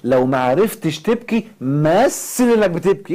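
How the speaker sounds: slow, high, loud mimicking of a prayer teacher; mocking teacher. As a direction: parody the instructor's advice. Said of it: "كان من ضمن الناس اللي كانوا بيعلمونا الدعاء يقول لك". (تقليد ساخر لمعلم الدعاء)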